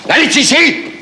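high, urgent correction; sharp command. Acted. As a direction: order the hostages about urgently. Robber orders hostages about. (scharfer Befehl)

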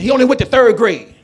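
ordinary talk

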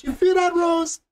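high, hammed-up Cajun accent; playing a Cajun Jack, awed and coaxing; Cajun Jack impression. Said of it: "He goes".

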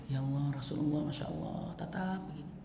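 hushed awe. Acted. Thauban gazing at the Prophet in awe.

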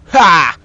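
sudden loud "ha"; explosive combat shout; loud attack yell. Real, not acted. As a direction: strike with a short burst of voice. Striking out in the game.

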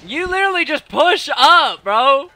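high, loud, slow exasperated yell; hyped gamer bro. Real, not acted. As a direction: yell it slowly at your rival. Frustrated yelling at opponent.

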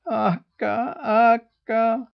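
high, pitiful wailing gosling voice. A narrator's reading. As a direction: wail the leader's name pitifully. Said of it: "wailed the goslings pitifully".

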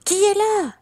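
high, loud call into the dark; sudden loud frightened call; startled cry. Acted. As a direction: shout up at the rustling leaves. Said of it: "Il cria".

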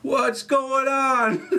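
mock R-Truth hyping the crowd, laughing through it; R-Truth impression. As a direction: stretch it out high while cracking up. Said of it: "he goes".

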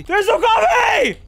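frustrated shout. Real, not acted. Tired player finding no coffee, yelling. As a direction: wail it out in despair.

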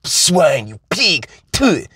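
put-on high, foreign-accented terrorist sneer; a hammed-up foreign accent spitting at an American; mock foreign villain accent, pitched high; vague foreign villain accent. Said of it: "don't ask me where this, um, accent is from".

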